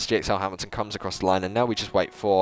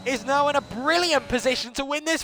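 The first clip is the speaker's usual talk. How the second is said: higher, lively race commentary; live play-by-play. Acted. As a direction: call the lap for the viewers.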